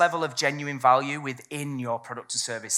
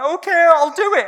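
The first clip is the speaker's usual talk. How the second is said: high, loud caving-in voice; hammed-up client giving in; mock tearful client; 13 semitones higher, 10 dB louder than usual. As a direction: squeak out a giving-in yes. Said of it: "and it's like".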